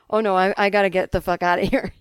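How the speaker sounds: hint of an odd accent, fed up; Mark's unplaceable accent. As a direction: blurt it as the accented kid giving up. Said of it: "he had some accent".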